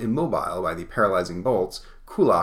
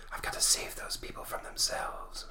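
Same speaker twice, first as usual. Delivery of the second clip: hushed spectral whisper; soft, voiceless whisper; ghostly whisper; 10 dB quieter than usual. Acted. Spectre resolves to save people.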